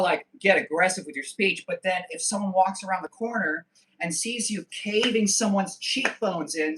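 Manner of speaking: ordinary talk, nothing marked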